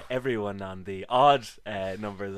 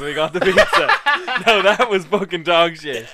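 laughing; 6 semitones higher, 9 dB louder than usual. Real, not acted. Laughing retelling bad pizza.

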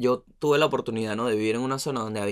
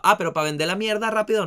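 sarcastic retort; 9 semitones higher, 4 dB louder than usual. Real, not acted. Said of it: "sarcastic retort".

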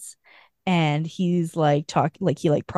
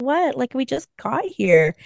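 high, indignant whine; pouty protest; 7 semitones higher than usual. Retold or imitated. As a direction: play the brat who wants to stay. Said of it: "she's all pouty".

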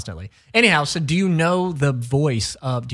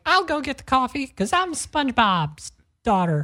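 put-on bratty kid; 9 semitones higher than usual. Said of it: "she's probably like".

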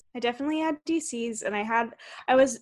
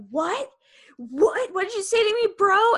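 offended bro; 9 semitones higher, 4 dB louder than usual. Retold or imitated. Skaters offended.

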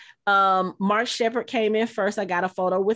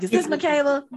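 higher-pitched take on the TV character; retold character voice, pitched up; Bobby Singer; 6 semitones higher than usual. Actor asking for the daughter on the phone, imitated character voice. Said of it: "in his Bobby Singer voice".

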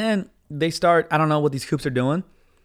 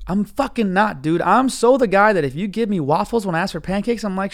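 usual speaking voice

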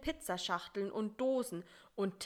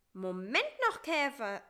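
lifted, moderately raised call; 5 semitones higher, 4 dB louder than usual. A narrator's reading. Fly calls back the departing beetle, calling out. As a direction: call after someone, lifting the name. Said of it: "rief Bissi".

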